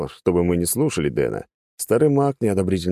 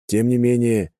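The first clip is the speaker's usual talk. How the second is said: ordinary delivery, nothing marked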